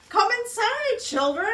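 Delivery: calling out. Acted. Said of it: "calls Daddy Pig".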